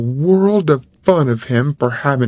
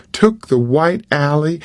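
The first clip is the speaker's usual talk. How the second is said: plain reading voice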